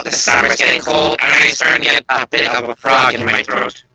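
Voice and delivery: electrolarynx-like distorted voice; buzzing throat-mic sound; robot voice. Real, not acted. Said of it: "microphone on your throat".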